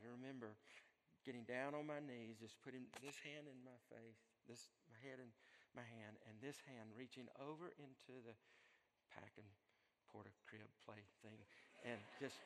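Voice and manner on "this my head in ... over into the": usual speaking voice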